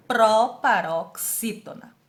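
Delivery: "proparoxítona" slowly split into pro, pa, rox, í, to, na; syllable by syllable. Shown as a demonstration. Teacher giving the class the answer, syllable by syllable. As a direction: say "proparoxítona" in loud, drawn-out beats.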